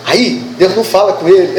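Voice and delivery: usual speaking voice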